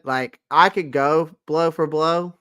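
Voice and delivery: normal talking voice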